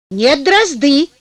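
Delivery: громкое упрямое возражение мужа; упрись и возрази погромче; заспорил. A narrator's reading. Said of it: "заспорил муж". (упрямо, споря)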